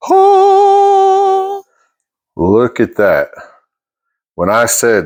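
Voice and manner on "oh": high loud amazed oh; excited high oh. Real, not acted. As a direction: gasp it out in delight. Sees the pie unmolded.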